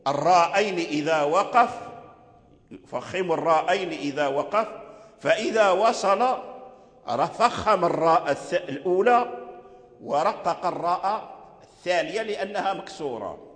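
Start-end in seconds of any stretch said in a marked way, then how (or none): none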